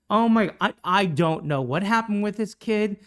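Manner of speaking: usual speaking voice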